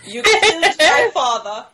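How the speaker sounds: dramatic laughing movie quote; Inigo Montoya. Retold or imitated. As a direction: belt out the famous line, half laughing. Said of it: "He says".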